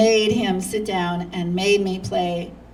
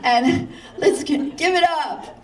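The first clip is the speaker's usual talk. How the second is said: higher, slow showman announcing a star, laughing through it; hammed-up MC intro; 8 semitones higher, slower than usual. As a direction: ham it up like a stage host introducing the famous guest. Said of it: "he introduces me to the audience as".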